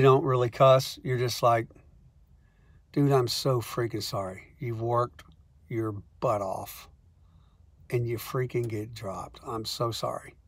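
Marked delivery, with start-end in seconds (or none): 2.9-10.3 soft, sincere condolence; sympathetic. Retold or imitated. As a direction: console a friend who got dropped, softly. Consoling dropped artist.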